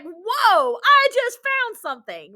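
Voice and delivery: loud, high excited outburst; surprised. Acted. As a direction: burst out amazed at your own discovery. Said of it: "He's surprised".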